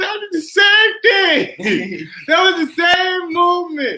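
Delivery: high, drawn-out indignant cry with laughter; laughing, wailed retold protest; incredulous protest. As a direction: stretch the words out in high, laughing disbelief. Protesting, incredulous, while retelling.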